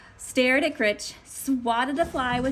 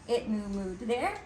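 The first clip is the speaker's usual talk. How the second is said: ordinary delivery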